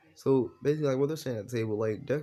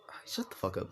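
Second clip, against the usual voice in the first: pouty; 4 dB quieter than usual. Retold or imitated. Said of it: "with a little pouty face".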